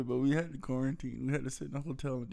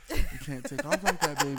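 louder, amused correction; putting voice in; 8 dB louder than usual. Retold or imitated. Partner re-enacting the driving correction with more voice, louder. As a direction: project it, with laughter around it. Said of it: "I'm trying to get some voice".

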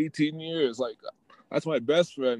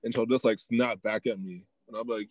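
normal talking voice

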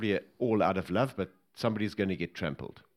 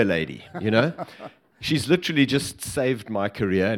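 ordinary delivery

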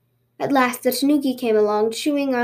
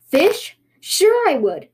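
higher, excited tanuki; eager; 6 semitones higher, 4 dB louder, much slower than usual. Acted. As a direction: jump eagerly at the offer. Tanuki tempted by fish.

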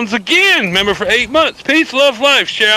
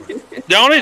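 ordinary talk

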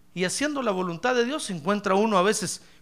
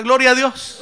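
called out louder; loud prompt; 4 semitones higher, 12 dB louder than usual. Shown as a demonstration. Preacher prompting the congregation, called out loud. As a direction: shout the praise for them. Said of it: "A ver, diga".

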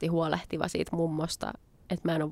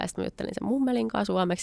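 normal talking voice

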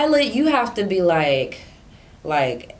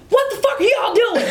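retold sergeant yelling at the troops; angry yell; 12 semitones higher than usual. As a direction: switch from sweet to furious in a beat. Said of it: "He's like".

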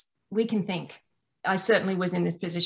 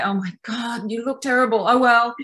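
a dismissive jab at yourself in the mirror, a touch louder; mock mean self-talk; 2 semitones higher, 4 dB louder than usual. Mildly voicing the mean inner critic to her mirror self, dismissive. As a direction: toss off the insult lightly, then shrug it away. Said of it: "we might just say".

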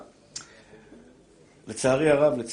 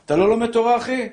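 stern challenge; 5 semitones higher, 5 dB louder than usual. Real, not acted. Preacher scolding the congregation.

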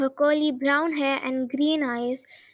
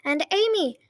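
raised voice, high and ringing; calling upstairs; 5 semitones higher than usual. A narrator's reading. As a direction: call up the stairs. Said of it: "raising her voice so it would be heard".

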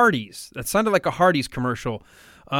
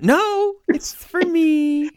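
high, drawn-out protest, hammed up; mock indignant refusal; 11 semitones higher, much slower than usual. Acting out the antiperspirant ad refusal, high mock protest. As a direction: play a possessive owner refusing, pitched way up.

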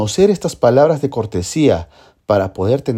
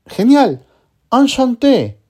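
much higher, louder, delighted; excited praise; 8 semitones higher, 3 dB louder than usual. Acted. Teacher cheering a student's answer, excited. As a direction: celebrate the right answer. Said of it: "teacher praising a learner".

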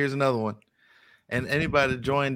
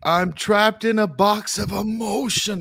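higher, louder movie-line quote; Anchorman quote; 8 semitones higher, 4 dB louder than usual. Retold or imitated. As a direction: ham it up like the film. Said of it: "That's my favorite movie, Anchorman".

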